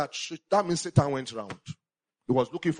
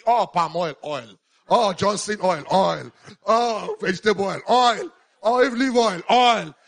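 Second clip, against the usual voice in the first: loud mock exclamations, pitched higher; mocking list; 6 semitones higher, 8 dB louder, slower than usual. Preacher mocking churchgoers who fuss over oil, loud and sarcastic. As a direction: ham up each fussy "Oh" and the oil after it.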